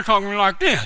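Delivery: demonstrating a numb tongue and lips; numb-tongued speech. Shown as a demonstration. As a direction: talk as if your tongue won't move. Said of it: "my lips were numb and my tongue was numb".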